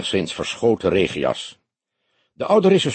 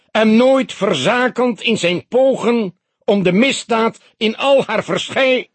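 booming solemn address; 12 semitones higher, 4 dB louder than usual. A narrator's reading. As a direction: let the words ring through the hall. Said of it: "galmde de stem gedragen verder".

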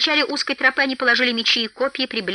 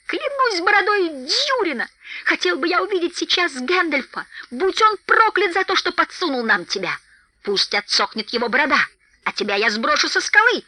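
higher-pitched angry ranting; raging curse; 7 semitones higher than usual. A narrator's reading. Thorin holding Bilbo up, cursing in rage. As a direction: curse him with rising fury.

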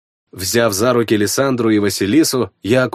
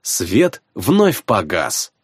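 ordinary delivery

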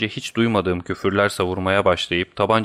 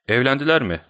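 breathless; 6 semitones higher, faster than usual. A narrator's reading. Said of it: "diye sordum nefes nefese".

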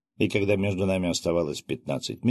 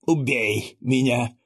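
slow, hoarse, hate-filled plea; hateful rasp; 5 semitones higher, slower than usual. Acted. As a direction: rasp it out with hatred, slowly. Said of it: "с ненавистью прохрипел он". (медленно, хрипло, с ненавистью)

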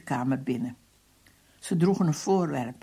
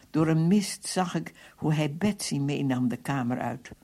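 ordinary delivery

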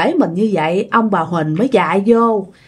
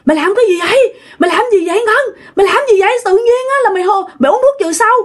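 much higher, hurried mock yelling; frantic scolding cry; 11 semitones higher, much faster than usual. Grandmother berating Ngân for drinking pesticide, crying out frantically. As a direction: scold frantically, as if catching someone mid-disaster. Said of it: "bà ngoại la quá trời".

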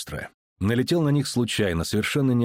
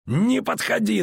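yelling; 11 semitones higher, slower than usual. A narrator's reading. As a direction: scream the threat to keep him back. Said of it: "заорал он".

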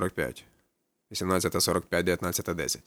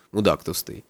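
usual speaking voice

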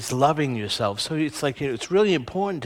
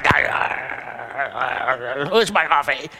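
hammed-up angry grunt for coffee; mock road rage grumble. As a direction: huff like you brought road rage into the office. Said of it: "held all that road rage".

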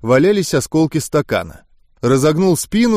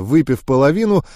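plain reading voice

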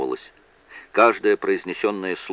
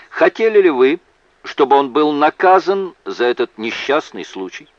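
raised, louder lawyer's voice; emphatic courtroom question; 8 semitones higher, 6 dB louder than usual. A narrator's reading. Lawyer asking the witness about punishment, emphatic questioning. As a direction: put it forcefully to the witness.

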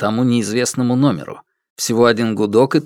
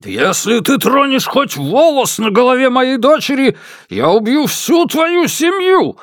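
higher, furious bellowed threat; roaring; 13 semitones higher than usual. Acted. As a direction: roar the threat in rage. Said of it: "взревел Ма Дунчень".

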